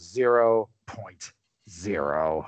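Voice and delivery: slow, low deadpan verdict; deadpan dean. Retold or imitated. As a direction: say it slowly, low and flat. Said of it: "He just looks at Bluto and he goes".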